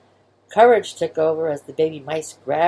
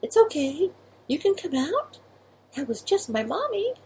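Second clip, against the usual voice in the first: soft whisper; 10 semitones higher than usual. Acted.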